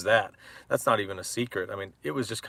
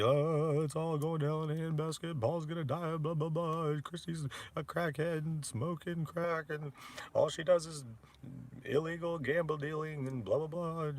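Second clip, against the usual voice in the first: higher, whiny put-on voice of a fretful mother; slow and softer, mimic a worrier moaning; higher, softer mock of a gloomy mom; mocking his mother-in-law; 6 semitones higher, 7 dB quieter than usual. Mother's constant negativity imitated.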